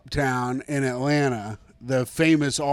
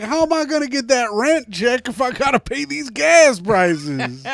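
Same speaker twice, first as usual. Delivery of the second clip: mock Rent Check; 8 semitones higher than usual. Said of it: "'Cause he's like".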